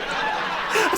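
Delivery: high airless burst of laughter; a silent wheezing laugh; breathless laugh. Real, not acted. Laughing at her own comeback to the girlfriend.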